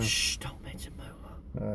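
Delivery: slow, secretive whisper; conspiratorial mock whisper; mock hushing. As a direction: hush them as if the name is forbidden. Mock hushing about forbidden name.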